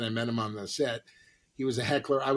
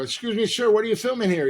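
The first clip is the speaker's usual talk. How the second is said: eager high question; 9 semitones higher, 5 dB louder than usual. Retold or imitated. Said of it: "I go".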